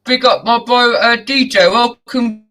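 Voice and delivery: high, loud host shout-outs; big up the crew with energy; hyped shout-out. Real, not acted.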